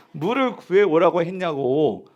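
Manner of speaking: ordinary delivery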